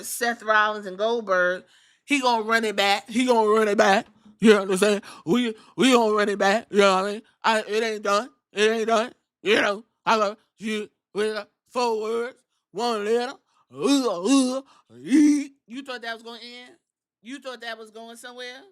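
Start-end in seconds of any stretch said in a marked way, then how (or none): 2.0-15.5 lightly voiced hyped-up wrestler promo; Jey Uso. Wrestler promising a rematch with Gunther, mock promo voice. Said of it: "essentially said that him and Gunther". 15.7-18.6 much softer, questioning aside; hushed mock questioning. Host questioning the rambling promo, hushed mock disbelief.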